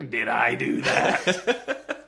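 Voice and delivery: lightly voiced, drawn-out comic line; mock cameo catchphrase. Stan Lee tipped over, to camera, slow comic catchphrase. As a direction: draw it out slowly for a laugh.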